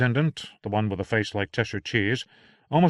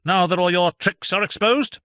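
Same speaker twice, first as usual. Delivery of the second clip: high, loud triumphant physician; gloating doctor; 10 semitones higher, 6 dB louder than usual. Acted. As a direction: rub it in. Voicing Doctor John.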